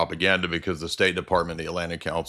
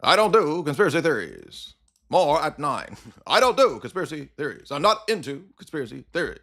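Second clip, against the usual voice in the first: mock high pompous voice; imitating David Wood; 7 semitones higher, 4 dB louder than usual. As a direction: parody the host, pitched up and loud. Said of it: "and David Wood said".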